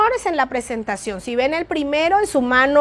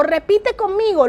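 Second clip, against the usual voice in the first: Repite conmigo said higher; emphatic cue; 6 semitones higher than usual. Shown as a demonstration. Teacher modeling a call for the class to repeat. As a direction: invite them to repeat. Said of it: "palabras como esta".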